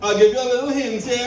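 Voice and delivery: ordinary delivery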